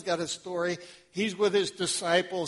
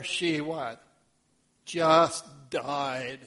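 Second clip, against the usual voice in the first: ordinary talk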